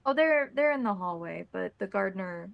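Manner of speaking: usual speaking voice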